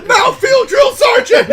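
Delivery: high, loud shouted reply with laughter; barked military recruit reply. Pretend. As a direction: shout it at the sergeant. Recruit reply, mocking the bit.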